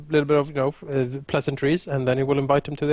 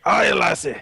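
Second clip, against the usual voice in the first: hearty put-on Scottish greeting, raised pitch; higher, playful Scottish accent; 4 semitones higher, 4 dB louder than usual. Shown as a demonstration. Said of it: "you need a more Scottish accent".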